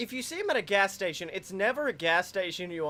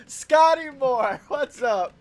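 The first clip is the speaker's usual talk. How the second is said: hyped greeting; 5 semitones higher, 8 dB louder, slower than usual. Pretend.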